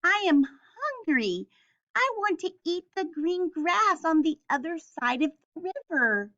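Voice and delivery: high, small billy goat voice; little goat voice. A narrator's reading. Little goat answers the troll in a high small voice.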